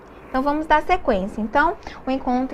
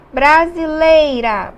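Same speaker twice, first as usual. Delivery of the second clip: Brasileira said slowly and louder, falling; slow model word; 6 dB louder, much slower than usual. Shown as a demonstration. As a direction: spell out Brasileira slowly for the class. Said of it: "Nós temos aqui duas vogais". (Brasileira dita devagar e mais alto)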